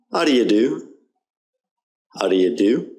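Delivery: "How do you do?" with "do you" reduced to d'yuh; weak vowels. Shown as a demonstration. Teacher modelling for repetition, "do you" as d'yuh. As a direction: run "do you" together, ending on a rising "do". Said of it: "listen and repeat along".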